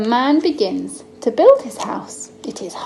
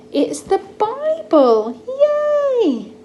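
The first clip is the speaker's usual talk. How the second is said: bright, high cheer at the name; excited reveal; 13 semitones higher, 4 dB louder, much slower than usual. Real, not acted. Mum cheering to her son about the Bible, excited. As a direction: cheer it out for the little one.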